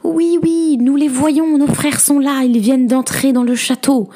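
very high, jubilant shout of news; excited cry. A narrator's reading. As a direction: cry out that rescue is here. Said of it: "Ses sœurs s'écrièrent".

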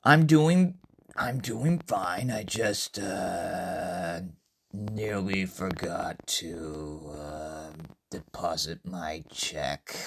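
low, slow, hesitant mumble; sheepish. Acted. Iridis admitting a nearly forgotten check, sheepish. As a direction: trail down low and soft, halting.

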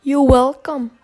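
loud, prim lady's reply; reply like a refined southern belle; Piper voice line. Retold or imitated. Imitating Piper's line for viewers, loud.

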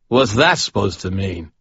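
lightly voiced drunk, a touch of offence; a hint of a tipsy man taking offence; mildly indignant drunk. A narrator's reading. Chuck's indignant drunken glare.

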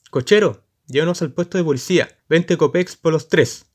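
alto y fuerte, llamando; llamada a gritos. Acted. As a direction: grita al cochero desde la calle. Jóvenes llaman al cochero. (los jóvenes gritan al cochero)

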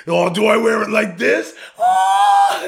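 loud, high hammed-up yell; mock shout. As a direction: yell it like the dad in the karate belt. Retelling Jingle All the Way scene.

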